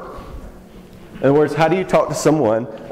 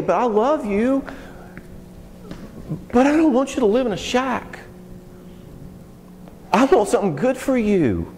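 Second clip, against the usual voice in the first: God pleading lovingly; 10 semitones higher than usual. Acted. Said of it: "And God steps back and says".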